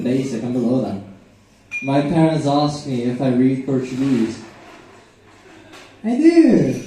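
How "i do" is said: pitched way up, loud fake claim; high squeaky lie. Son lies to parents he reads Portuguese, squeaky high. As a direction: squeak it out like a guilty kid.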